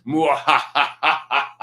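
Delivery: hammed-up villain cackle; mock evil mwahaha cackle; Matt's evil laugh. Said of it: "I'll do a proper Matt laugh".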